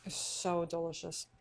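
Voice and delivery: slow, talking round a bite; mouth full. Real, not acted. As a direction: say it while chewing. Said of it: "can't talk with food in my mouth".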